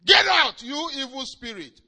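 high, loud shouted command; angry rebuke. Retold or imitated. Hagin rebuking the monkey spirit, angry shout. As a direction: cast it out in anger. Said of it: "he got angry and said".

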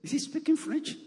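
high-pitched imitation of baffled listeners; puzzled listeners. Pastor quoting congregation on his fast talk, puzzled listeners. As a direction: lift it high as a mock question. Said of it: "you say".

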